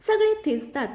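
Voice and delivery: ordinary delivery, nothing marked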